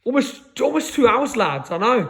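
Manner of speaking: ordinary talk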